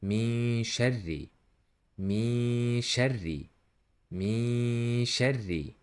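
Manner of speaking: slow "Min sharri" with hummed n and doubled r; doubled Ra, hidden nun. Shown as a demonstration. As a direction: hum into "sharri", holding the r. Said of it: "letter Ra here with E doubling".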